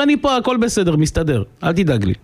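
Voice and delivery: high-pitched, breezy reassurance; carefree boy. Retold or imitated. Son to father from the forest, carefree. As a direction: wave off Dad's worry in a boy's voice.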